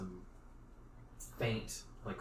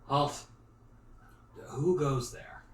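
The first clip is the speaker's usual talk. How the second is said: loud, raised guard's call; sentry hailing strangers, louder and higher; guard's challenge; 5 semitones higher, 9 dB louder, much slower than usual. Acted. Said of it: "one of the guards does speak up and asks".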